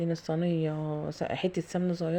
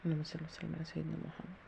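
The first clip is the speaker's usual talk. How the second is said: softer prayer spoken to herself; soft murmured prayer; 10 dB quieter than usual. Real, not acted. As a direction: quietly bless the work under your breath. Prayer while pouring batter.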